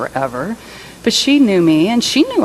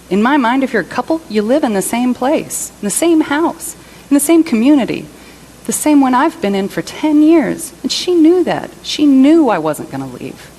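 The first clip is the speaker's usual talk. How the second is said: rising upset; 7 semitones higher, 3 dB louder than usual. Acted. A partner left behind insists couples live together, voice rising. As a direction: pitch climbing higher and louder with each phrase.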